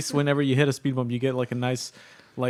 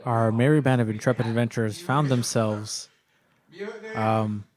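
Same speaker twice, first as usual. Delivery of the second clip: game master narration; 3 semitones lower, slower than usual. Acted. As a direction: set the scene for players.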